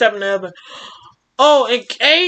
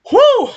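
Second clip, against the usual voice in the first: high relieved whoo; 13 semitones higher, 5 dB louder than usual. Real, not acted. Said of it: "That felt good".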